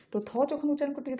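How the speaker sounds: ordinary talk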